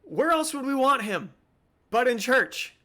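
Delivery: high, loud, incredulous retort; retelling how he snapped at the churchgoers, high and loud; exasperated outburst. Retold or imitated. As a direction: throw it back at them, baffled and loud. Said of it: "I was like".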